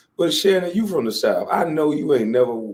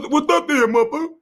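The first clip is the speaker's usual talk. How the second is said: tough Shannon impression; 12 semitones higher than usual. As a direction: bark it like jumping out the car. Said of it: "That is".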